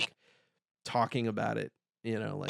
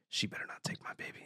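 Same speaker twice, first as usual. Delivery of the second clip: hushed, suspicious whisper; retold paranoid muttering, barely audible; 9 dB quieter, faster than usual. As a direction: whisper it under your breath, guarding the baby.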